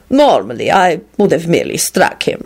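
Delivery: understated cool explanation. A narrator's reading. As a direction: explain it with detached calm. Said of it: "Radu responded coolly".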